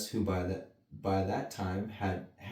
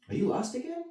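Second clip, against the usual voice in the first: high teasing cat voice; Cheshire Cat; faster than usual. Acted. The Cheshire Cat mocks Alice, high teasing. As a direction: tease her from the tree. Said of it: "he asked".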